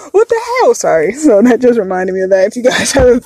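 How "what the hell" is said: high, loud, fast mock scream; imitated scream. Retelling the video where the daughter ends up screaming, imitated scream. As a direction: mimic the girl's screamed outburst through laughter. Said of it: "like, screaming".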